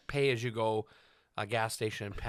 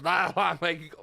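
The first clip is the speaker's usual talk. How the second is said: ordinary talk, nothing marked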